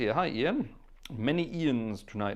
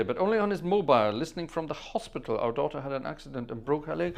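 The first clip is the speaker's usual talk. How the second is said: ordinary delivery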